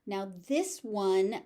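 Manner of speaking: ordinary talk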